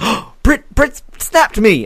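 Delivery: high, excited gasped reaction; mock thrilled. Podcaster re-enacting seeing Britt's Snapchat, thrilled.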